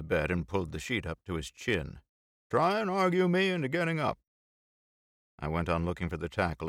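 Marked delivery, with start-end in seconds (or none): 2.5-4.2 higher, teasing challenge; daring him playfully; Bill voice. A narrator's reading.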